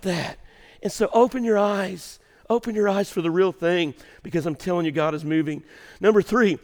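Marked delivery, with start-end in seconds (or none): none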